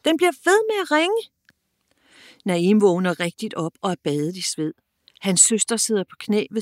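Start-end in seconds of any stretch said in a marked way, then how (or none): 0.0-1.3 high exasperated shout. Acted. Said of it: "råber Nadia igen". (irriteret råb)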